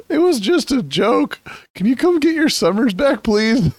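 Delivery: put-on high-pitched, pleading Canadian accent. Canadian begging Texas to take summer back, in a Canadian accent. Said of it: "Like, in a Canadian accent".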